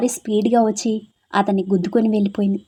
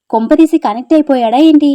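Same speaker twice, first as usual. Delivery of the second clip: higher, louder startled guess; alarmed surprise; 4 semitones higher, 6 dB louder than usual. Acted. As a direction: gasp out the worrying thought. Girls shocked he follows bus. (ఆశ్చర్యంతో కంగారుగా అడగడం)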